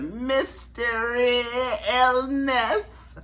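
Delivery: high, slow, stretched-out mock voice; a high, drawn-out impression of a comedian's punchline; imitating Katt Williams. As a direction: draw each word out high like a comedian's bit. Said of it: "when he talked about Katt Williams".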